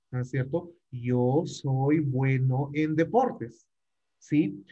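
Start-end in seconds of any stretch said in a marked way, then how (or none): 0.9-3.7 slow, clear repeat. Shown as a demonstration. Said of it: "teacher repeating slowly for clarity".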